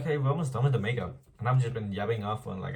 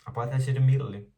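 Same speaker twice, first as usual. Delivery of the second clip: fast, loud, higher gush; excited rush; 4 semitones higher, 8 dB louder, much faster than usual. Real, not acted. Telling viewers about the buy, excited and fast. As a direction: blurt it out with glee.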